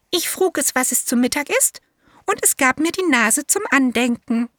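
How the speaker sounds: high, eager little elephant voice; elephant child. A narrator's reading. Elephant child answers the python in a high childlike voice.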